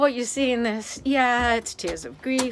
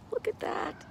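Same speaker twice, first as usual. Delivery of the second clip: awed whisper; 11 semitones higher, 9 dB quieter than usual. Real, not acted. Repeats it breathlessly in awe.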